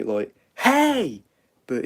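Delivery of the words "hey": very high loud "Hey!"; American hey. Shown as a demonstration. Showing the American call, high loud "Hey!". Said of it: "in America they say".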